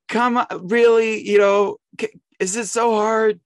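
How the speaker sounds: exasperated impatience. Retold or imitated. Exasperated impatience at slow line.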